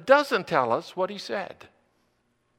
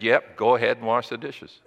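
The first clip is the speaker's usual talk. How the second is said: dry parental retort; 6 semitones lower, 4 dB louder than usual. Retold or imitated. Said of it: "And we said".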